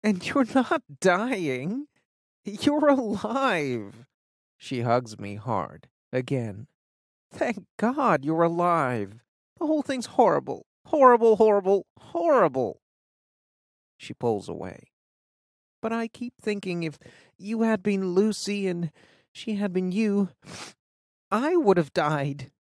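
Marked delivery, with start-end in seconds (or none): 0.0-12.8 tearful friend. Acted. Fiona tearful, reassuring. 15.8-22.5 put-on higher girl's voice, earnest; shaken teen friend. Fiona confides fear.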